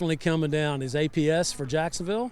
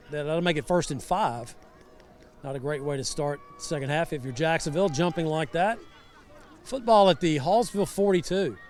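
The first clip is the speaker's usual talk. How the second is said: usual speaking voice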